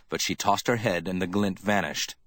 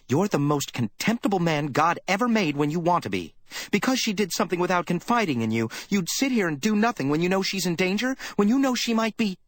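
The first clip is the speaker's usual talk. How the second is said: higher, heated scolding of her boss; a worried girl lashing out in contempt; young secretary, furious; 11 semitones higher than usual. Acted. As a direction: tear into him for doing nothing. Effie voiced, furious at Spade.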